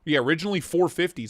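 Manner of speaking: usual speaking voice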